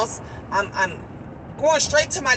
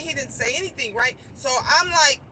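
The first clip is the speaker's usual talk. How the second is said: ordinary delivery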